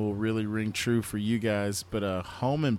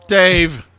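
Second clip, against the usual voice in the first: loud drawn-out hype call of the name; crowd-pumping shout of Dave; 5 semitones higher, 13 dB louder than usual. Acted. Hyping Chappelle Show.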